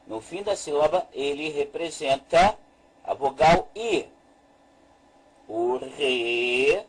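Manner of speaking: usual speaking voice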